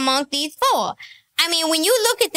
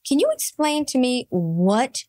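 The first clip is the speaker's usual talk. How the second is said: normal talking voice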